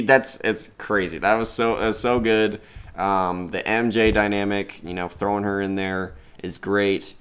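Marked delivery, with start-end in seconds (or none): none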